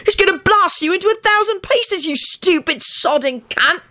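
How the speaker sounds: high-pitched, indignant tirade; furious barked scolding at a high pitch. A narrator's reading. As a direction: bark out an angry insult, pitched well up. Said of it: "Sirius barked out".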